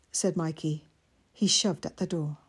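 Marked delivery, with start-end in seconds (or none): none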